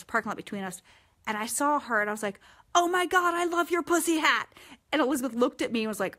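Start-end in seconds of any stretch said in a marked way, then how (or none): 2.7-4.5 higher and louder excited call; retold friendly yell; shouted across a lot. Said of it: "screaming across the parking lot".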